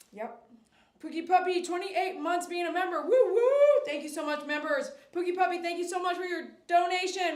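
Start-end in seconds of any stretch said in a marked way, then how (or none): 3.0-3.4 high, loud woo woo; excited cheer. Real, not acted. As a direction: cheer for the long-time member. Cheering member anniversary.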